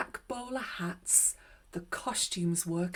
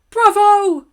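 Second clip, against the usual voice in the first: very high, loud cheer; an excited crowd yelling bravo; 12 semitones higher, 19 dB louder than usual. Acted. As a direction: shout it out as a thrilled audience. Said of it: "they cried".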